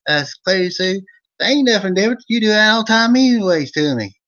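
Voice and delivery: high, loud hillbilly storyteller from Eastern Kentucky; Old Vern character voice. Acted. Host greeting his viewers as Old Vern, hammed-up high folksy voice. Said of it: "it's the Old Vern Show".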